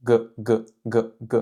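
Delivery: G said as in baguette; throaty G, repeated; hard G. Shown as a demonstration. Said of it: "le son G dans le mot baguette". (G dur comme dans baguette)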